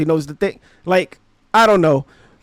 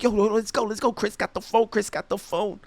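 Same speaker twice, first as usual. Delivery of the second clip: hushed, higher urgent mock chatter; retold urgency; 3 semitones higher, 8 dB quieter than usual. Retold urgent friend urging escape.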